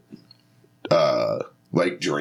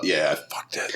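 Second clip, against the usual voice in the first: ordinary talk, nothing marked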